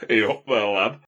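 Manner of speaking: loud, hammed-up Yorkshire greeting; Yorkshire accent. Shown as a demonstration. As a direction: call it out in broad mock Yorkshire. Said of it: "set in a Yorkshire accent".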